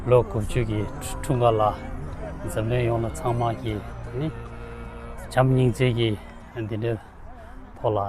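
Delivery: ordinary talk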